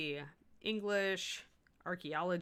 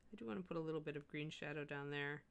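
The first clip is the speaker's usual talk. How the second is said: ordinary talk, nothing marked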